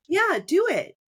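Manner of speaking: high, bright burst urging her to go on; eager encouragement. Real, not acted. Host eagerly urging the guest to share, high excited push. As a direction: cheer her into telling the story.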